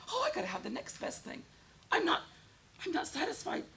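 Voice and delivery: high-pitched put-on dissatisfaction; mock discontent. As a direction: play a mildly whining, never-satisfied buyer. Voices a discontented gadget buyer.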